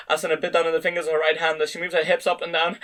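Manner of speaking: ordinary delivery, nothing marked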